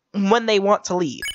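usual speaking voice

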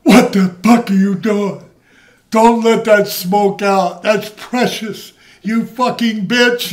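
hammed-up rant at her; Sheik yelling. Sheik yelling at Moolah in the car, mock furious. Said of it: "And then the Sheik goes".

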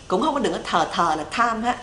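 ordinary talk, nothing marked

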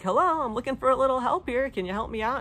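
playfully exasperated call for assistance; mock plea. Stuck speaker to listeners who won't jump in, mock exasperated plea. Acting out her plea for help.

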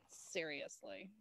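mock the lady under your breath; dismissive low muttered seriously; eye-roll scoff. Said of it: "rolling my eyes going".

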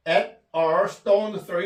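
very slow, each word drawn out; slow model reading. Shown as a demonstration. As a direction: spell it out slowly for the class. Teacher models English phrase slowly. (아주 천천히 늘여 읽기)

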